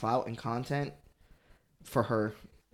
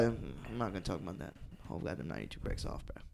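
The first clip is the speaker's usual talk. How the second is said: quiet aside; 8 semitones lower, 14 dB quieter, faster than usual. Real, not acted. Lowered aside about [name].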